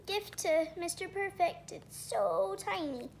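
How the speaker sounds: ordinary delivery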